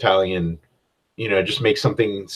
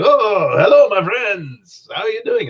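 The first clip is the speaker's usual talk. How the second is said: Hondo impression; 11 semitones higher, 3 dB louder than usual. Game master greets players as the smuggler Hondo, over the top and much higher. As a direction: ham up a flamboyant rogue welcoming friends. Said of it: "Hondo smuggler character who's just, like, over the top".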